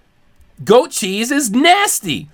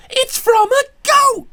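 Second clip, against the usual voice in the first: high, strained comic wail; exasperated climax; 12 semitones higher than usual. Acted. Preacher-ranter driving home the goat point, exasperated wail. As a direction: plead in disbelief, voice way up.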